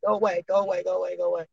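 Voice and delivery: low, soft repeated muttering; muttered shooing. Real, not acted. Guest shooing an unwanted caller, low soft muttering. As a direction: mutter it under your breath at the phone.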